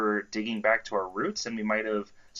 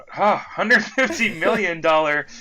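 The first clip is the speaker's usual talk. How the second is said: slow, loud, stretched out in disbelief; can't believe the number; incredulous emphasis; 8 semitones higher, 8 dB louder than usual. Real, not acted. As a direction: hammer the fifty, amazed. Incredulous correction of huge amount.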